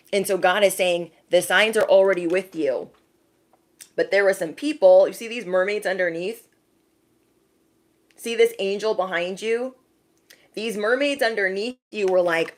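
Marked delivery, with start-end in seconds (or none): none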